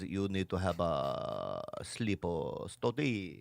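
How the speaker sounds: very slow, low, soft doctor voice; Doctor Kawashiri. Retold or imitated. Doctor prescribing a sleep study, slow low impression. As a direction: stretch every word out, halting and hushed. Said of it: "he goes".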